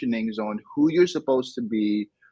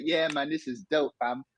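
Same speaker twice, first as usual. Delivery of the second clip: high, softer London youth slang voice; imitated London crew talk, pitched up; London street accent; 5 semitones higher, 3 dB quieter than usual. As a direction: chat like a London kid among mates, in London accent. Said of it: "I was in England like".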